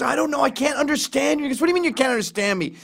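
mock bickering. Nick and David quarrelling in the elevator, high. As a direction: bicker in a rising huff.

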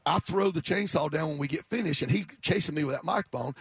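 ordinary talk, nothing marked

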